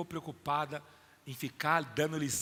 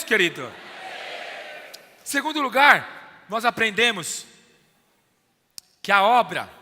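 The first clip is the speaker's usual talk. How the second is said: preaching at full force; 5 semitones higher, 14 dB louder than usual. Acted. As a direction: build it up and proclaim to the church. Preacher builds to loud climax. (pregação inflamada, bem alta)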